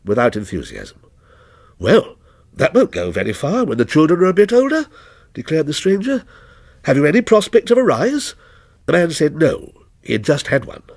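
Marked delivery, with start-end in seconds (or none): 1.8-8.3 a hint of a cool, aloof stranger, higher and louder; lightly voiced stranger, detached and ominous; stranger's voice. Lightly voiced stranger answering the night watchman, a touch aloof.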